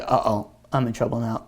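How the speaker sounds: low, soft worried aside; lightly acted sinking feeling, under the breath; muted dread. Student summoned by the Baal Shem Tov, quietly worried.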